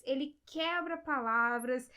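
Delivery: usual speaking voice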